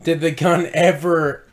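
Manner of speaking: ordinary delivery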